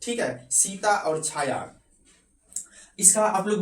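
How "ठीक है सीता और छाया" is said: Sita Chhaya drawn out, syllable by syllable; slow example. Shown as a demonstration. Teacher slowly dictates Sita Chhaya to students taking notes. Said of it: "इनको notes बनाइए".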